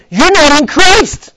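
slow, loud, high accusing voice; accusing demons. Acted. Demons taunting the believer, slow and loud. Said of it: "the demons come and say".

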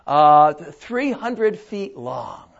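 God stating the size, unhurried; God, slow and calm. Acted. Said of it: "So God says to Noah".

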